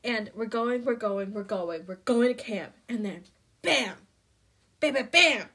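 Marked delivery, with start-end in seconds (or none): 3.6-5.4 loud, explosive bams; booming storytelling sound effect; imitated crash. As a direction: punch out each bam like a sudden strike. Imitating the sudden lightning strike.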